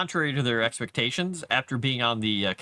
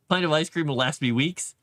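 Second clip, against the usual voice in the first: normal talking voice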